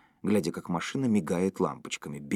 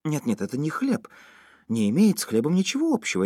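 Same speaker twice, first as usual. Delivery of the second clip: dismayed denial; 7 semitones higher, 3 dB louder than usual. A narrator's reading. Женя horrified, denying it is bread.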